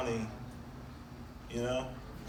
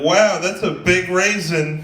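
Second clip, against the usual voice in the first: loud, high, drawn-out mock wonder; hammed-up astonished exclamation; mock amazement; 6 semitones higher, 11 dB louder, slower than usual. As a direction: marvel loudly, stretching every word. Acted amazed quote in a joke.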